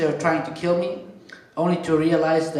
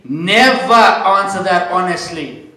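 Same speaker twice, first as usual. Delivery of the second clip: slow, louder stage warning; emphatic warning; 9 dB louder, slower than usual. Acted. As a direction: hammer the advice home to the men. Punchline warning to the crowd.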